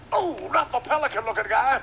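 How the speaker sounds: high, loud cartoon rooster bluster; hammed-up preacher-rooster voice; Sharpton Leghorn. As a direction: boom it out like a blustering cartoon bird. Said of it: "Sharpton Leghorn".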